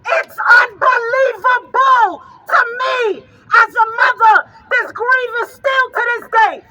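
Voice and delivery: loud, slow, tearful rally cry; a grieving mother crying out at the crowd; grief-stricken shout. Real, not acted. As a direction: shout it out through real tears.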